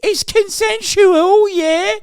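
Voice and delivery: high, hammed-up British geezer accent, stretched slow on consensual; mock British geezer. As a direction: sneer it like a cartoon London lad. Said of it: "the British authorities would be like".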